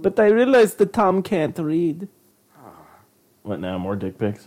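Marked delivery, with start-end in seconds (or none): 0.0-2.1 high mock voice. Giggly mock commentary.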